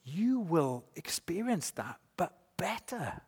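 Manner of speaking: soft, slow, savoured assurance; hushed promise. Real, not acted. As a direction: drop the volume and draw out each word.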